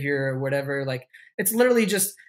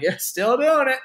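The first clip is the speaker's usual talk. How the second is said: mock cheerful reply; 9 semitones higher, 4 dB louder than usual. Answering fan, mock-cheery reply.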